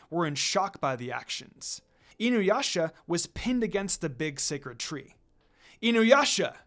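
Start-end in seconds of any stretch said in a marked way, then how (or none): 5.8-6.6 louder, higher alarmed yell; yelling his name. Acted. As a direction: cry out as he is pinned. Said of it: "They yelled out his name".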